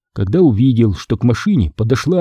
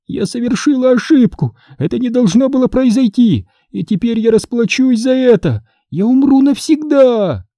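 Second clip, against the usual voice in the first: very high, theatrical wail; high-pitched ghostly despair; despairing dying ghost; 11 semitones higher than usual. A narrator's reading. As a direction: lament in a high voice, dragging out the end. Dying ghost rages and laments.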